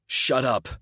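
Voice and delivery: sharp loud bark; barked order. A narrator's reading. Narrator barking at Mocha, sharp loud bark. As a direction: bark it at her. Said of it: "I bark".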